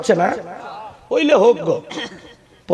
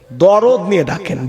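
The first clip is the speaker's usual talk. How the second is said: usual speaking voice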